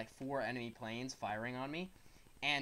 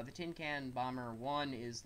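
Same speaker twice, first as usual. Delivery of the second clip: ordinary talk, nothing marked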